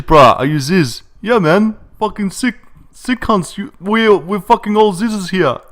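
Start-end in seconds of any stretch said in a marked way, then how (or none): 1.2-5.6 much higher-pitched Aussie gym-bro voice; Ziz impression. Asked if he sounds like Ziz, he plays the gym bro, higher. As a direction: ham up the swaggering Sydney gym bro. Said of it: "why do you sound like Ziz".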